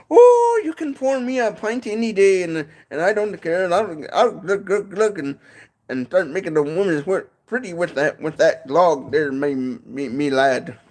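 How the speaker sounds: high, hammed-up Irish brogue; Irish accent. Vlogger performing a jolly Irish pub man, hammed-up Irish. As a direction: ham up a stage Irishman in the pub. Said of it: "do a little bit of an Irish accent".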